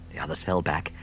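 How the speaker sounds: usual speaking voice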